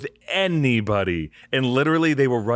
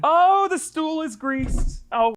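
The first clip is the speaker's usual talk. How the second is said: startled; slower than usual. Real, not acted. Sudden slip on greased stool.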